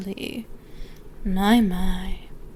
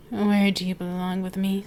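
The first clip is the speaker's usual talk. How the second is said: ordinary talk, nothing marked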